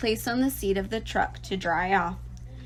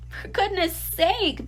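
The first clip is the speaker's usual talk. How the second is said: bellowed; 10 semitones higher, slower than usual. A narrator's reading. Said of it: "bellowed Mister Zuckerman".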